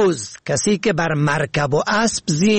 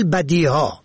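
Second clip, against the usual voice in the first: ordinary talk, nothing marked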